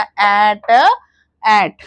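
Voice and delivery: एट said as one short sound, loudly. Shown as a demonstration. Teacher showing mothers the sound, loud and clear. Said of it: "इसको sounding से बोलना".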